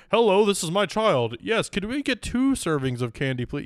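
put-on a kid faking a grown-up at the door; kid acting like an adult. Kids in a trench coat asking for candy, mock-polite grown-up voice. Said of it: "'cause they're acting like adult".

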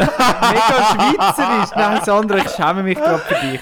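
loud, high, laughing mock shame; laughing outcry. Real, not acted. Friend mocks her Swiss correctness. (lachend empört, laut und hoch)

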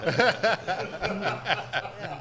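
high chuckling burst; amused laugh. Real, not acted. Reacting to a friend's nine wins, high laugh. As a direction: laugh in disbelief at the tally.

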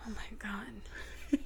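breathy disbelieving whisper; exasperated whisper. Real, not acted. Exasperated at partner's question.

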